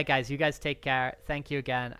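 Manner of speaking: ordinary delivery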